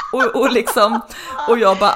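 laughing through the words; can't hold the laugh back telling the dialect story. Real, not acted. Laughing at boyfriend's failed dialect attempt.

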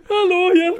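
high, loud pretend panic; hammed-up desperate call; cry for help. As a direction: wail for help from a dark lift. Trapped in lift, calling for help.

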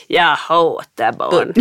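low, slow grumpy moan; grumpy smurf. Retold or imitated. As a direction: drag it out like a sulky Grumpy Smurf. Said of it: "du måste också ha buttesmurfen". (långsam, låg sur buttesmurfsröst)

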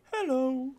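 put-on storybook character voice; cutesy storybook hello. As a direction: sing out an innocent little character hello. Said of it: "Christopher Robin's thing, where it's then like".

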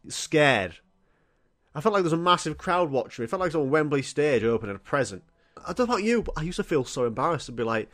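normal talking voice

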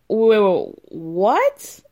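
loud whoa, then a rising what; mock shock. Dorit hearing about the car rolling, mock shock. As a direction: exaggerate a stunned double take.

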